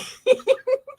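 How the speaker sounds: squealing laughter; high squeal laugh. Real, not acted. Laughing fit.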